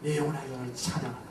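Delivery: soft, quick murmur of "내 영혼아, 여호와를 찬양하라"; quiet quick command. Shown as a demonstration. Preacher showing the congregation the command, soft and quick. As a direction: say "여호와를 찬양하라" softly and fast, under the breath. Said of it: "오더, 명령하십시오".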